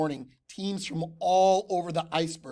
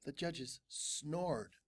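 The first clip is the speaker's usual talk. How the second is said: slow sleepy delivery; 2 semitones lower, 12 dB quieter, much slower than usual. A narrator's reading.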